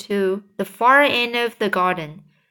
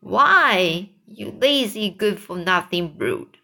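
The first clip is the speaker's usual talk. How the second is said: plain reading voice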